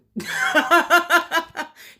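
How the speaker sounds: long, high, loud laugh; sustained high cackle; real laugh. Real, not acted. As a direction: burst out laughing at your own joke. Laughs at own joke.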